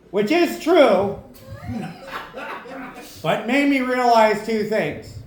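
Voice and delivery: usual speaking voice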